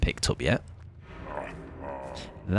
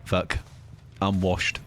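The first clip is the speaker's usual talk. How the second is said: normal talking voice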